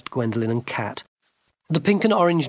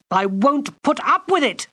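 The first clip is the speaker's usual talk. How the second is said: high shouted refusal; girl shouting at the enchanter; 13 semitones higher, 4 dB louder than usual. Acted. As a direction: shout it at him. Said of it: "Gwendolen shouted at him".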